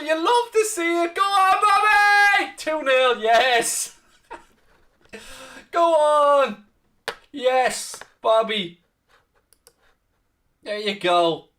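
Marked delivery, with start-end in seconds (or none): 0.0-8.8 excited. Real, not acted. Calming down after celebration.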